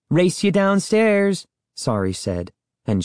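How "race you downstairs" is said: teasing challenge. A narrator's reading. Sister challenges brother to race.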